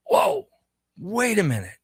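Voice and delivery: high-pitched, startled whoa; sudden realization. Retold or imitated. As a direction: act out an idea suddenly striking. Retells his sudden late-night realization.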